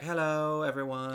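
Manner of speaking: put-on light British accent greeting; a touch of a British actress's accent. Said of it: "with that type of accent".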